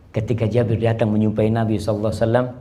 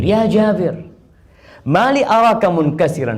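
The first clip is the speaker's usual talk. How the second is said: high, louder call; voiced Prophet calling; 6 semitones higher, 5 dB louder than usual. Preacher voicing the Prophet asking sad Jabir, raised. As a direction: call his name with concern.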